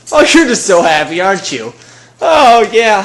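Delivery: loud, high cooing to a pet; gushing pet talk. Real, not acted. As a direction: gush over an excited animal.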